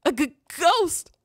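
high, stuttering acted fright; stammer, then jump up in pitch on the scare; startled stammer. Character sees a ghost at night, acted startled stammer.

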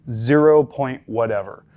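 zero point whatever, slowed, zero leaned on; slow down and hit the zero; stress on zero. Shown as a demonstration. Instructor showing how to say doses, stressing zero. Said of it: "But I'm emphasizing the zero".

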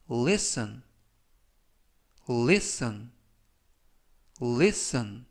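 listen said clearly three times, with pauses to repeat; careful pronunciation demo. Shown as a demonstration. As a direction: model listen slowly for learners. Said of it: "이라고 발음하시면 되겠습니다". (listen을 또박또박 세 번 발음 시범)

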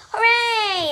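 high, loud cheering yell. A narrator's reading. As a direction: cheer it out at the top of the voice. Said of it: "yelled the crowd".

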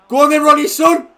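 high loud cheering; roaring the striker on; urging shout. Real, not acted. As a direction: drive him on with a yell.

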